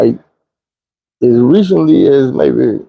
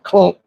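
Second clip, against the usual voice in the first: higher imitated clunk; clunk sound; 6 semitones higher than usual. Telling how the hit felt, a higher mock clunk. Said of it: "It felt like what I just said".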